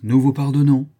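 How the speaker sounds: grand and loud, haughty; magnanimous, superior forgiveness; lofty. A narrator's reading. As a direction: bestow pardon from on high. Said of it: "dis-je d'un air superbe".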